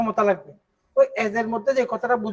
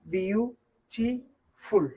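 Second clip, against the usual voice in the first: very slow, softer, a clear gap after each syllable; 5 dB quieter, much slower than usual. Shown as a demonstration. Teacher counting out the many syllables for students, syllable by syllable. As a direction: break "beautiful" into drawn-out, separate syllables. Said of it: "অনেকগুলো syllable না?".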